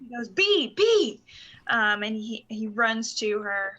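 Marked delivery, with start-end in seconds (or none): 0.3-1.2 high, louder calling B; father calling to his daughter, louder and higher. Acted. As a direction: call to your little girl.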